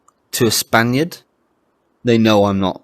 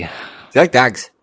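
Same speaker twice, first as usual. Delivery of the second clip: imitated Irish brogue; mock Irish film quote; film-quote Irish accent; 3 semitones higher, 3 dB louder, faster than usual. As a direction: mimic a line from Snatch in mock Irish. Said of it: "are you trying to quote Snatch".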